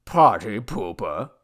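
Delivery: slow tipsy grumble; grumbling. A narrator's reading. Drunk god whose party is over, grumbling. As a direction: drag it out sulkily. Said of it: "grumbled the god".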